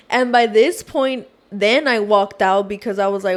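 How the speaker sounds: usual speaking voice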